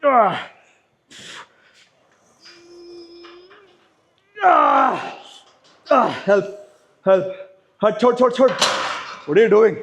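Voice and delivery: loud pained cries; strained shouting. Real, not acted. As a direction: yell through the strain. Strained rest-pause rep.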